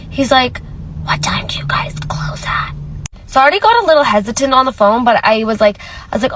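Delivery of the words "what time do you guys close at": faked raspy whisper, barely voiced; mock sore-throat whisper. Caller faking a sore throat to the cashier, whispered. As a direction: whisper like you can't use your voice.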